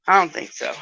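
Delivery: ordinary talk, nothing marked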